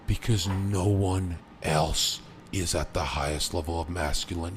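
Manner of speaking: ordinary talk, nothing marked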